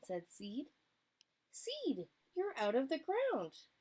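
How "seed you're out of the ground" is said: higher, delighted surprise; a raccoon thrilled his friend has sprouted; surprised raccoon. Acted. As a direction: gasp it out, amazed.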